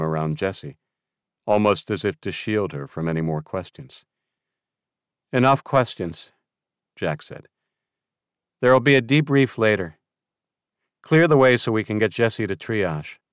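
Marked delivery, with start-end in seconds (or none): 5.3-13.2 firm. A narrator's reading. Jack shutting down questions firmly.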